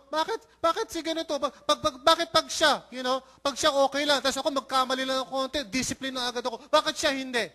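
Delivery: high-pitched mock indignation; envious protest. Pastor imitating church member disciplined while another gets away, envious protest. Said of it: "What is that? Envy".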